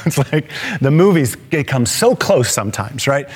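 ordinary delivery

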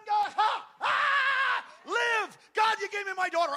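a father losing his mind at the window; frantic shouted prayer. Retold or imitated. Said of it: "I'm like / I lost my mind".

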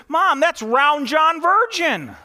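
put-on high, loud, slow child's voice with a laugh; a little girl naming the big man to her mom; little girl punchline. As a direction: deliver the punchline as the proud kid, laughing. Punchline in the girl's voice.

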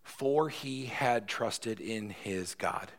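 slow, deliberate, every word weighted; slow emphasis. Shown as a demonstration. Preacher answering why to the congregation, slowly.

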